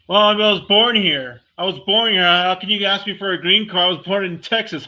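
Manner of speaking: mocking an outraged "born here" claim; mock indignant reply. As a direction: ham up an offended American's protest.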